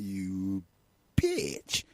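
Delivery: mock-spiteful jibe under the breath; imitating Charles. Charles glaring at Diana while dancing, mock spite. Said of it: "he was probably saying".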